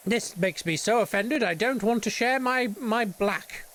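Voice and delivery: high, whiny mock artist in a huff; mock-offended Anish Kapoor. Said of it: "And he's like".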